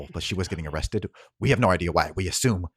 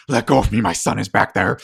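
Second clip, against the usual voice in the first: angry shout; 10 dB louder than usual. Acted. Harlan shouting at the guard to let him go. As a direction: shout it, furious and desperate. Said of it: "He goes".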